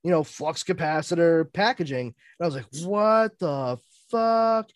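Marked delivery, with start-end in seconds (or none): 2.8-4.7 slow, drawn-out astonishment; dumbfounded, word by word; stunned disbelief. Retold or imitated. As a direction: stretch each word in amazed bafflement. Own stunned reaction, retold.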